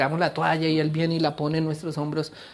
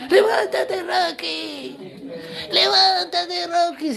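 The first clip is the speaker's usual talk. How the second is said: high, loud, strained coach's cry; hammed-up old trainer yelling, words stretched; imitated little old man; 13 semitones higher, 4 dB louder, much slower than usual. Old trainer urging knocked-down Rocky, high hammed-up yell. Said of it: "un abuelito".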